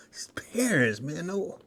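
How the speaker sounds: hushed whisper of disgust; whispered dread. Retold or imitated. Kid spotting a classmate's parents, whispered dismay. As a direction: whisper it so nobody hears.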